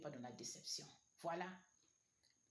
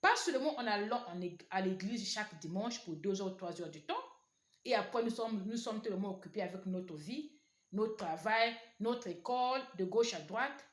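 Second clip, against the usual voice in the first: loud, high fervent preaching; preaching at full force; 4 semitones higher, 9 dB louder than usual. Acted. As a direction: cry it out to the church. Climax of sermon, shouted exhortation.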